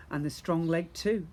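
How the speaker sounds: usual speaking voice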